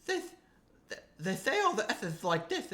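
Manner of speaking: mock British lisp, S's turned to "th"; lisping British accent. Co-host demonstrating a strange British accent, lisping. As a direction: imitate a lisping British speaker. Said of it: "they have a lisp".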